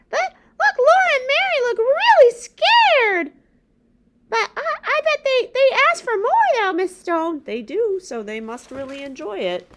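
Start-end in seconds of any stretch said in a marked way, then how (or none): 0.1-8.0 high, loud, eager puppet voice with a gasp; excited puppet. Acted. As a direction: gasp and beg to hear more.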